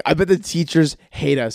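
ordinary talk

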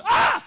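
high, loud mock scream of the crowd; imitated scream. Pastor imitating the crowd's blackout scream. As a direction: shriek like the startled crowd.

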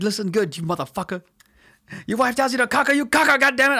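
high, loud, hollered dad impression; gruff-talking movie dad. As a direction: imitate a worked-up dad laying down orders. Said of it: "He's like".

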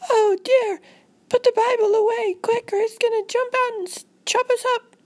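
very high, loud frantic squeal; hammed-up squeaky terror; panicked girl. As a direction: shriek a warning like a scared child. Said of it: "And she was like".

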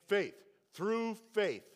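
emphatic answer. Shown as a demonstration. Preacher answers his own question with the congregation, loud. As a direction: proclaim it with the crowd. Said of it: "answer with the congregation".

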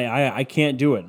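normal talking voice

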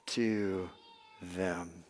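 soft, slow, kindly falling away; gentle and kind. Acted. Preacher describing Joseph to his brothers, softly tender. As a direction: let it land tenderly on them. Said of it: "spoke kindly".

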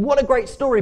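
high, loud enthusiasm; delighted reaction. Acted. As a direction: exclaim it as a thrilled listener. Voices the hearers' delighted first reaction.

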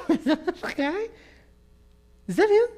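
high-pitched, incredulous questioning; astonished neighbours. Acted. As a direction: play the baffled neighbour, voice way up. Neighbours react in disbelief.

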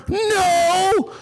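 high, shouted mock refusal; high whiny mock holler; mock pessimist. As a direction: wail it like a useless bystander. Mocks useless pessimist answering questions.

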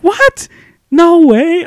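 very high, loud disbelief; imitated astonished kids. Students hearing she was his teacher, high-pitched astonishment. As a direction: mimic children squealing in shock.

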